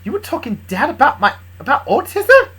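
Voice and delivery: high, loud incredulous question; surprised. Acted. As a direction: pitch leaps up in disbelief. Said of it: "Luna looked surprised".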